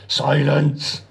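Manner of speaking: short, curt bark of command, not bellowed. A narrator's reading. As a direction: snap the order out briefly. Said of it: "barked Decker".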